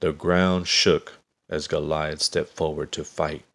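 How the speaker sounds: ordinary delivery, nothing marked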